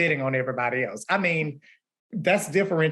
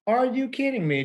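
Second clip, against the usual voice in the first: mock outrage at needing pencils; incredulous; 9 semitones higher than usual. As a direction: throw the question up high in disbelief. Repeated incredulous quote, louder.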